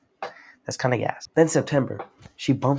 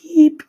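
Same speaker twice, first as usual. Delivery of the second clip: high, loud imitated electronic beep; one high-pitched mimicked beep; scanner beep; 13 dB louder than usual. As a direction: sound a piercing machine beep.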